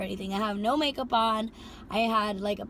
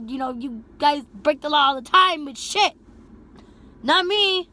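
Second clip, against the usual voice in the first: higher and louder rant; angry scolding; 6 semitones higher, 7 dB louder than usual. Retold or imitated. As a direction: tear into him. Scolding passenger about the law.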